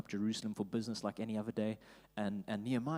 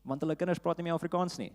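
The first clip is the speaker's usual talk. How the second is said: fast, higher joking aside; joking punchline; 6 semitones higher, 4 dB louder, faster than usual. Pretend. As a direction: throw it off as a joke. Jokey punchline in Afrikaans.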